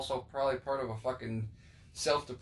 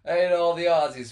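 loud; 4 semitones higher, 12 dB louder than usual. Real, not acted. Jokey outburst about Aussie listeners.

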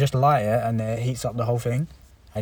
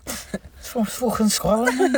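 high, slow mock Scottish accent; a jokey attempt at a Scottish accent; 10 semitones higher, much slower than usual. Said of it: "that's not the correct accent".

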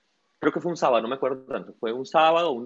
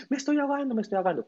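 imitating a panicked swimmer, much higher; mock desperate plea, pitched up high; panicked cry; 12 semitones higher, faster than usual. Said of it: "el hombre desesperado". (súplica de pánico imitada, mucho más aguda)

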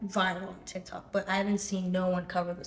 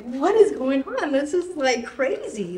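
high, louder bursts of disbelief; shocked disbelief; 7 semitones higher, 9 dB louder, slower than usual. Retold or imitated. Recalling her shock at going viral, high disbelieving outburst. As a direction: blurt it out like you can't believe it.

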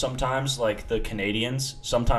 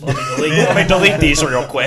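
mock panic; 6 semitones higher, 11 dB louder than usual. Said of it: "hope they didn't read the Snapchat messages".

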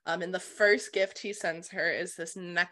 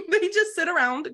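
laughing, much higher and louder; 10 semitones higher, 7 dB louder than usual. Real, not acted. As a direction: giggle it out. Laughing at stereotype.

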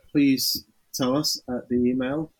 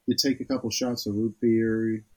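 put-on American accent demo; 6 semitones lower than usual. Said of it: "shall I do the accent?".

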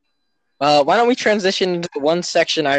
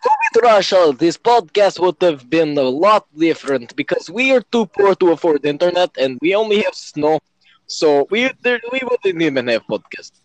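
hammed-up Russian accent for laughs; mock Russian accent. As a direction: play a poor Soviet podcaster with a Russian accent. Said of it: "I prefer the Russian, because the Russian is fun".

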